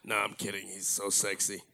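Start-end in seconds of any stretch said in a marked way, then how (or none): none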